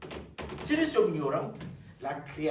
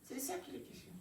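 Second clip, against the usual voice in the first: much softer, as if letting it sink in; hushed repetition; 9 semitones higher, 14 dB quieter than usual. Real, not acted. Same line repeated softly. (tout bas, comme en écho)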